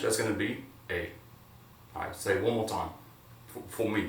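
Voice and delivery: ordinary delivery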